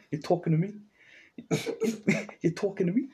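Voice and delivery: lightly done tough-guy De Niro line; a quick mock De Niro challenge; De Niro impression. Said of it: "looks like Robert De Niro".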